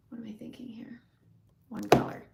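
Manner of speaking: a hushed private thought; whispered musing. Real, not acted. Host whispers to herself while picking a color. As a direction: whisper it to yourself.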